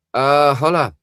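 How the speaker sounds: high, loud, cheerful call; excited holla. Real, not acted. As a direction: sing out the greeting. Loud greeting to a viewer.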